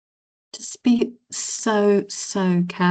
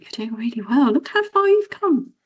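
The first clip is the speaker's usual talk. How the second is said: rising high, encouraging praise; caring coach; 6 semitones higher than usual. Retold or imitated. Caring coach cheering a tired runner, climbing high praise. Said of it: "the caring coach is like".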